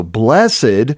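Blessed read as two syllables; Blessed as bless plus ed. Shown as a demonstration. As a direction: give Blessed a separate e-d syllable. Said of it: "Two syllables here".

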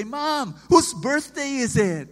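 a hint of excited kids asking; lightly voiced kids. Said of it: "they would say".

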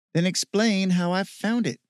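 high, loud, smug challenge. A narrator's reading. Steve challenging Michael over the gold, smug. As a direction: throw it back triumphantly, pitch up. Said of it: "He oozed smugness".

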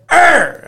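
sharp high loud growl; retold growl at a chewing puppy. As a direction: bark a quick growl at the puppy. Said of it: "I growled at her".

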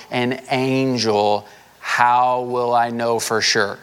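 slow, each word drawn out; slow it right down so it sinks in; deliberate slow repeat of the question; said real slow. Shown as a demonstration. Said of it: "let me say it again real slow".

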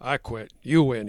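ordinary delivery

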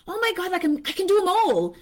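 high, eager boast; giddy overconfidence. Retold or imitated. Telling husband her list, giddy overconfidence. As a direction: sure you can do it all. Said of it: "And I'm like".

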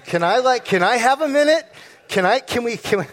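high, loud mock panic; panicked stalling. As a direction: stammer and plead for time, voice jumping up. Husband stalling when asked about love.

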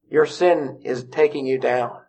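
ordinary talk, nothing marked

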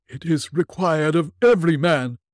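high, loud wailing ghost; unearthly voice. Acted. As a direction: wail like a tormented spirit. Ghost speaks.

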